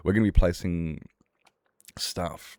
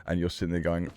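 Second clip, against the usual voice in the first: normal talking voice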